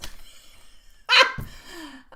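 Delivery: a short, high, real burst of laughter; amused laugh. Real, not acted. Mother laughing about her son calling her bra, real laugh. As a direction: laugh out loud at the son's word.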